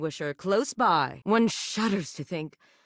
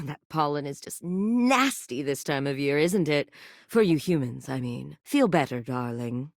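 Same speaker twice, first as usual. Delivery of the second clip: usual speaking voice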